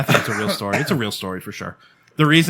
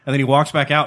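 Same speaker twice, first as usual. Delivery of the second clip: normal talking voice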